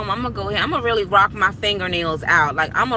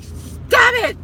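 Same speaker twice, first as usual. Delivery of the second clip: high mock shout of protest; retold shout; 13 semitones higher, 4 dB louder than usual. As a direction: yelp it as if your ears hurt. Retold reaction to daughter's screaming.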